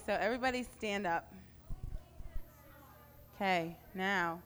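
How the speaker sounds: ordinary talk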